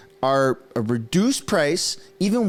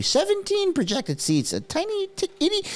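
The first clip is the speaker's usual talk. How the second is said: squeaky, much higher teasing tone for a tiny number; mocking baby voice. Belittling Jagmeet's seat count in a high mocking squeak. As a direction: shrink him down with a squeaky mock voice.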